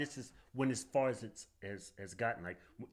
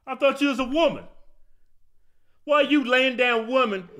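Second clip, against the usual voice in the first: incredulous; 8 semitones higher, 8 dB louder than usual. Real, not acted. Incredulous rant at the clip.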